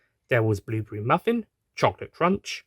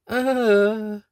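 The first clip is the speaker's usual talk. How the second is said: fainting groan; 9 semitones higher, 3 dB louder than usual. Acted. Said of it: "as she passed out".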